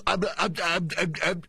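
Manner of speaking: stuttering imitation of a stuck speaker; mock stumbling. Host imitating her stumbling at the question, mock stumbling. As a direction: get stuck on the first word, mockingly. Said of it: "she stumbles out of the gate".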